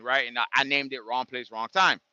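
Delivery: ordinary talk, nothing marked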